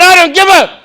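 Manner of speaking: high, loud screaming abuse; hammed-up rage; furious shout. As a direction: scream back at the officer. Retold shouting match.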